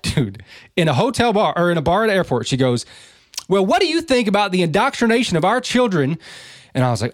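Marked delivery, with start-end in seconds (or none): 3.5-6.2 high-pitched, loud-talking woman imitation; retold loud question. Texas woman loudly questioning a stranger at an airport bar, retold loud. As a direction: imitate her blurting it out for the whole room. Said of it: "and loud as fuck".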